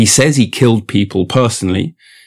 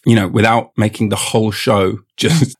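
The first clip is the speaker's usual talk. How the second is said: ordinary talk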